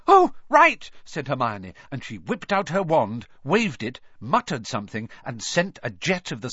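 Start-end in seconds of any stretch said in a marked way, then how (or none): 0.1-0.9 high loud sudden realisation; realising. Acted. Hermione suddenly realising she can make fire, high surprised. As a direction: suddenly get it.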